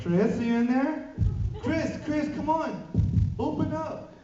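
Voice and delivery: loud, high, slow calls of a name through a door; calling through door. Retold or imitated. Fan outside the hotel door calls Chris loudly and high. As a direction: call him out from the hallway. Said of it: "and then".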